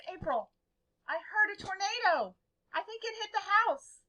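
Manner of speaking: acting out a worried dad on the phone; nervous dad. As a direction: retell it with rising alarm. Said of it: "He was really nervous sounding on the phone".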